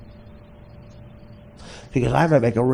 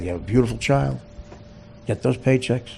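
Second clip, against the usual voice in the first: normal talking voice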